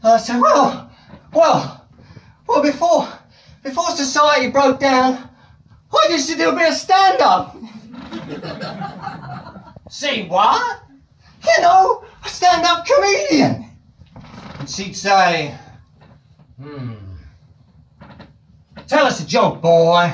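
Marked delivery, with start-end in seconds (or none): 0.4-13.6 high, anxious whining; nervous pleading. Pretend. 16.6-20.1 southern drawl. Retold or imitated. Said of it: "Southern States drawl".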